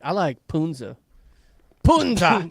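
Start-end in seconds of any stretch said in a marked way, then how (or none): 1.8-2.5 louder, higher burst on the word; hammed-up one-word yell; mock curse shout. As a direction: shout it like casting a silly curse. Mimics the favourite curse word.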